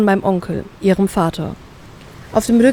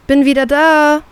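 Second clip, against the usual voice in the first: calling out louder and higher, bored; 6 semitones higher, 5 dB louder than usual. Acted. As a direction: shout home without interest. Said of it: "rufe ich gelangweilt".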